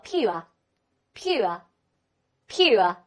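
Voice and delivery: British "pure" ending in an open uh, no r; British accent. Shown as a demonstration. Teacher models the other accepted reading, British accent. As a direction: say "pure" slowly, British style. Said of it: "在英国人的习惯当中也可以读作".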